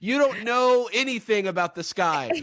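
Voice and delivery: hammed-up angry dude, pitched high and scornful; mock outraged dude. Podcaster imitating men replying to his friend online, mock outrage. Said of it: "every dude wants to be like".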